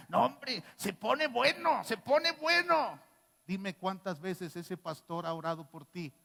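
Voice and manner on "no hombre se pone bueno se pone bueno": higher, slow, drawn-out gushing; preacher mocks a member praising other courses, slow and gushing; mock enthusiasm. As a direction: gush over it in mockery.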